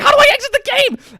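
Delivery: very high, loud frantic question; panicked yell. Retold or imitated. Player yelling at friend while trapped in boss room. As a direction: scream it in desperation. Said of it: "going back and forth".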